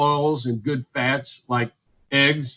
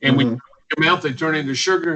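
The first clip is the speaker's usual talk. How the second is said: normal talking voice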